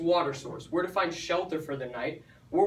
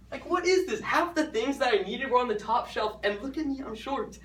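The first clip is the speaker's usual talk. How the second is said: high-pitched comic dismay; mock exasperation; 7 semitones higher than usual. Shopper facing a too-high shelf, high mock-exasperated whine. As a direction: pitch it way up in playful complaint. Acting out dismay at store shelves.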